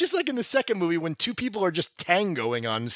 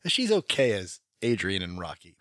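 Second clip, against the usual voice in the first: usual speaking voice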